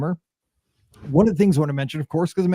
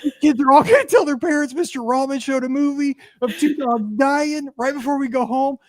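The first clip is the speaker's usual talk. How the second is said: retold crying; 10 semitones higher than usual. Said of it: "I'm crying. The kids are crying".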